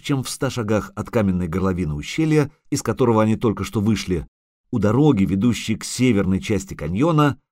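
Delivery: ordinary delivery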